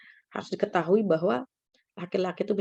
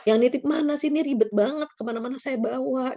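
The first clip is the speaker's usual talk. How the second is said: higher-pitched fed-up grumble; imitated complaint, pitched up; complaining; 4 semitones higher than usual.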